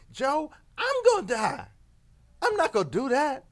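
almost shout it, indignant; protesting. Retold or imitated. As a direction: raise your voice as if it's obvious. Scorpion protesting loudly.

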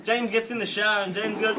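ordinary talk, nothing marked

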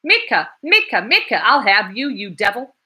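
loud, high furious chase yell; man yelling after Mitka on the stairs; yelling at top of voice. A narrator's reading. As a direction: scream the name down the stairwell. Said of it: "yelling at the top of his voice".

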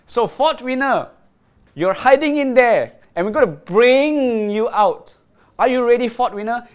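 loud, high hype call; hyped giveaway announcement. Real, not acted. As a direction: pump up the live viewers. Host hyping live giveaway spin.

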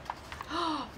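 soft quick gasp; hushed intake of surprise; anticipating gasp. A narrator's reading. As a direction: gasp before the reveal. Anticipates the grape.

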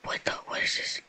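hushed scared whisper. Real, not acted. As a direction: whisper it in the dark. Creeping through dark game.

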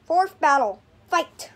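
loud announcer call; booming fighting game announcer. Acted. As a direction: boom it out like an arena announcer. Announcing the plushie battle.

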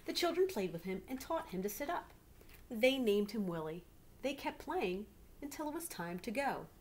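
put-on lively read-aloud to children; story narration. A narrator's reading. Teacher reading a picture book to her class, lively.

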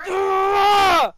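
high, loud roar of excitement; pumped-up celebratory holler; triumphant yell. Real, not acted. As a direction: let out a long victory growl-yell. Celebrating the rare card.

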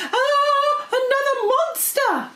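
high, loud shriek of terror; panicked pixie. Acted. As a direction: squeal it in cartoon panic.

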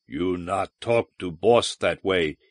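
slow, low rumbling brute voice; a big creature rumbling a slow warning; low rumble. A narrator's reading. Wocha warns Jansky off his boss in a slow, low rumble. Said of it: "he rumbled".

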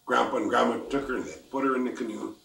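ordinary delivery, nothing marked

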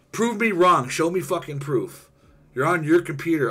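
high, loud demand to prove him wrong; real heated call-out to doubters; loud challenge. Real, not acted. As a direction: throw down the gauntlet at the cheaters. Challenging online cheaters, loud.

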